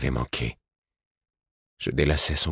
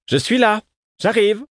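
much higher, louder call across the hill; 5 dB louder than usual. A narrator's reading. Mother calls out to her children, loud and high. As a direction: call out loud to be heard far off. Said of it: "puis lança".

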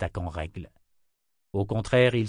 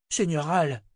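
higher-pitched call from the crowd; 7 semitones higher than usual. A narrator's reading. Townsman hails his lord from the crowd, calling out. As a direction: call out to get his attention. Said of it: "lança un homme".

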